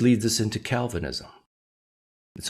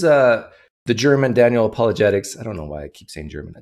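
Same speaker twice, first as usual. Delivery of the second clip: ordinary delivery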